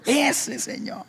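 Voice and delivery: put-on high child voice, accusing; girl accusing, high and pleading; child pointing. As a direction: point him out like a little girl.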